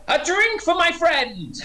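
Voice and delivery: high, drawn-out hearty holler; shouting across the bar. Acted. Orc calling to the bar for her new friend, high shout. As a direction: call out a drink order with gusto. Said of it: "Sort of shouting across the bar".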